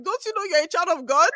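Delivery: high, loud teasing of her mom's line; mock preachy mom. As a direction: throw her mom's line back at her, joking. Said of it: "I'm like".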